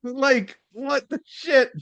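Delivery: very high-pitched disbelief; incredulous outburst. Retold or imitated. Recalling a shocking movie joke to co-host, incredulous. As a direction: squeak it out, utterly baffled.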